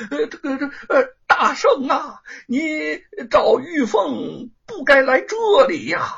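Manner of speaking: high, slow, stammering frightened voice; a cowering ruler pleading with the Monkey King; flustered and scared. Acted. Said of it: "阎王慌里慌张地". (慌张结巴地说)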